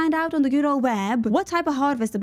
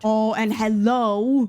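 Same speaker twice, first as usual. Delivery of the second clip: sing-song hello; 2 semitones lower, much slower than usual. Pretend. Drawn-out greeting to hay.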